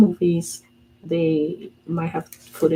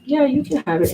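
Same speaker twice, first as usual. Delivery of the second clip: put-on higher, breezy boy voice; lightly voiced son; 9 semitones higher, 3 dB louder than usual. Her son offering it to her, a higher carefree voice. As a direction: toss it off like a carefree kid.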